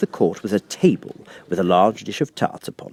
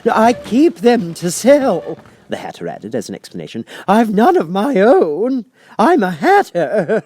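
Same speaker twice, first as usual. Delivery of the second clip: high, loud, slow fluttery Hatter; the Hatter apologizing to the king; 11 semitones higher, 7 dB louder, slower than usual. Acted. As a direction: play the flustered Hatter.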